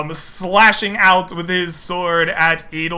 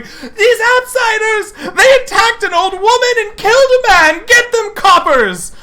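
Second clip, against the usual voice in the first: high, loud accusing yell; outraged shout; 14 semitones higher, 5 dB louder than usual. Acted. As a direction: cry out for the guards to seize them. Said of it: "the shouts of the barkeep and the barmaiden going".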